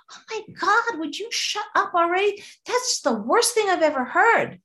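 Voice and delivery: a fed-up crow telling the singer to shut up; grumpy crow. Acted. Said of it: "and the crow said".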